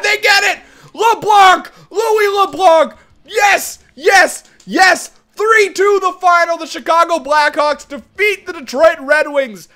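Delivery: high, loud cheering; thrilled goal call. Real, not acted. Gamer watching his team win in overtime, thrilled cheering. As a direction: yell out the winner like a fan.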